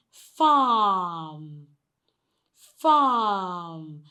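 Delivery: "farm" stretched on the "ah"; long vowel. Shown as a demonstration. Teacher models next word for repeating, long vowel. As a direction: draw out "farm", closing on m. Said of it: "Now the next word is".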